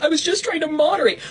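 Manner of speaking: high, whiny mock voice; simpering imitation of a friend; mocking quote of Krista. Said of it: "And she's like".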